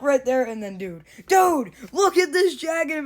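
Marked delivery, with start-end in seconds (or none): 1.3-3.0 excited. Real, not acted. Thrilled by the jacket.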